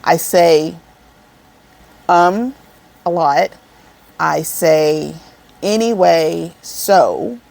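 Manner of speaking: spaced out with long pauses; measured, halting list with gaps between items; deliberately slow. Real, not acted. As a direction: say it slowly, word by word. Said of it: "I'm gonna say this slow enough".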